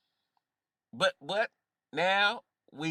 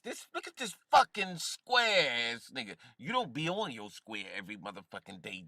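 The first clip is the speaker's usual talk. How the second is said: ordinary talk, nothing marked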